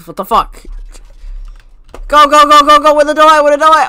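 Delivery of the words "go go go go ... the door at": loud, urgent, higher-pitched hurry; frantic shouting. Real, not acted. Player hunting for the door, frantic shouting. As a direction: shout it like the clock is running out. Rushing in game.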